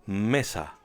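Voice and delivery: "Mesa" stressed on ME, falling away on sa; teacher's model "Mesa" with the stress on the second-to-last syllable, ME; stress on first syllable. Shown as a demonstration. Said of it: "on the second to last syllable".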